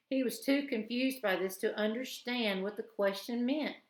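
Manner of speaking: ordinary delivery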